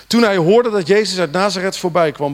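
normal talking voice

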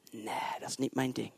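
soft, low offhand dismissal; dismissive. Retold or imitated. Preacher mimicking a picky believer dismissing miracles, soft and low. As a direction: brush it off lightly.

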